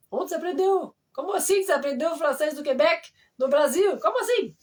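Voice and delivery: high, incredulous questioning; retold astonishment. Quebecers asking where she learned French, retold with amazement. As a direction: act out someone baffled, pitch climbing to the end.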